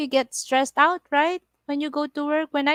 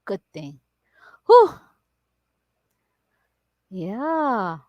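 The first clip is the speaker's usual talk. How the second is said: ordinary delivery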